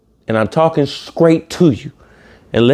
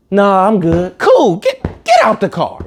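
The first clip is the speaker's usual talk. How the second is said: mocking blunt exchange; 7 semitones higher than usual. Acting out blunt rejection.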